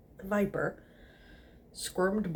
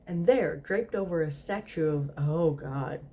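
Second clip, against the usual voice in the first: usual speaking voice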